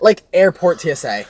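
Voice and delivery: ordinary talk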